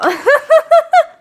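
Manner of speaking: high loud laugh; burst of laughter. Real, not acted. Streamer laughing at her own exaggeration, loud. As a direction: laugh out at yourself.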